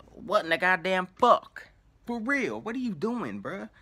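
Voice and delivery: imitating a stranger's shocked outburst; incredulous outburst. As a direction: blurt out "what in the goddamn fuck" in shock. Stranger reacting in disbelief.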